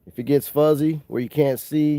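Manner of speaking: usual speaking voice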